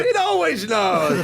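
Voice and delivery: high, slow cartoon toucan; laughing through a mascot voice; Toucan Sam voice. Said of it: "like Toucan Sam".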